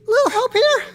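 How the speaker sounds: very high, strained plea from the ledge; high voice calling for help. Acted. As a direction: call up for help while hanging on. Angel dangling from the ledge.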